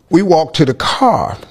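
usual speaking voice

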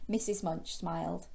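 ordinary delivery

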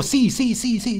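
high, playful insistence; mock insisting. Gamer teasing a fleeing bear in a mock argument, insisting. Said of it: "Yo digo".

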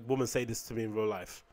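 usual speaking voice

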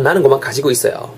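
ordinary talk

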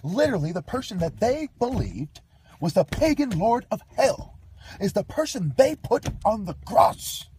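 high, loud, heated tirade; angry rant. Real, not acted. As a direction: rail at them in outrage. Ranting rant against listeners.

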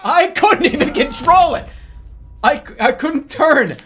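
loud, high-pitched protest; exasperated shout; indignant outburst. Real, not acted. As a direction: cry foul at the game. Protesting lost control in game.

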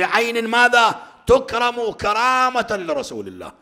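loud, high, impassioned exhortation; preaching at full force. Acted. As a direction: drive the point home to the crowd. (وعظ حماسي بصوت عالٍ ومرتفع)

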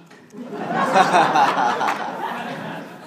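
a long loud burst of laughter; real long laughter. Real, not acted. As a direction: laugh hard at the memory. Laughing at own story.

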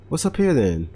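normal talking voice

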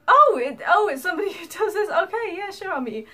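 high, loud startled laughing reply; a sudden giggly oh; flustered surprise. Retold or imitated. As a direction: caught off guard, laughing it out. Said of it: "And I'm just like".

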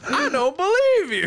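can't believe what you just heard; incredulous. Real, not acted. Hearing friend hurt thumb gaming, incredulous high cry.

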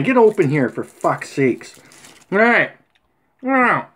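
normal talking voice

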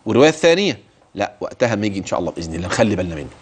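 usual speaking voice

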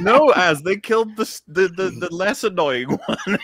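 high, loud laughing words. Real, not acted. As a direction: laugh through the line. Laughing at own joke.

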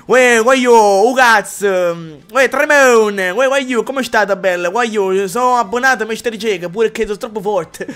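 loud hammed-up southern dialect, laughing through; mock dialect shouting. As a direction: bellow it like a stage Neapolitan. Reading chat dialects, laughing.

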